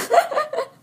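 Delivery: short high laugh at a slip; a quick, high burst of laughter; amused laugh. Real, not acted.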